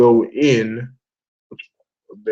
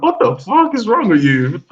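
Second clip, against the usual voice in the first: mock outrage; 10 semitones higher, 3 dB louder than usual. Crew yelling at Luffy.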